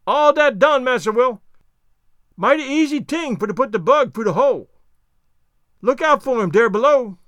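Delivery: high, loud dialect call; Jupiter, pleased. Acted. Servant warns master below of dropping bug, high loud call. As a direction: shout the finished job down as the servant.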